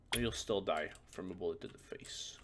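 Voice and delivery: ordinary talk, nothing marked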